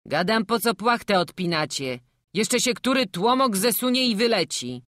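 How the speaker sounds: acted annoyed shout; cross scolding. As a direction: scold the sleepers from outside the wagon. Driver scolds the sleepers.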